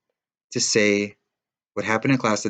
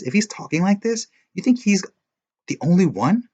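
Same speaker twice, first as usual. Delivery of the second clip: high, louder passionate scolding; heated reproach; 6 semitones higher, 4 dB louder than usual. A narrator's reading. Girl scolds the boy, high and heated. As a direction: shake him out of self-pity. Alicia confronting him heatedly.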